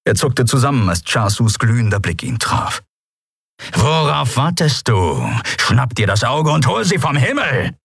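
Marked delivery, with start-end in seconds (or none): 3.7-7.8 angry command. Acted. Chasu glares at Larn and orders the shot, angry. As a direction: snap the order at the servant.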